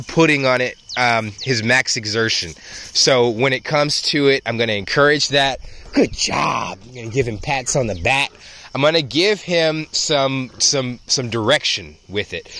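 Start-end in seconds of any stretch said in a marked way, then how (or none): 5.9-6.8 quick praise tossed to a dog; real dog-handler praise; encouraging praise. Real, not acted.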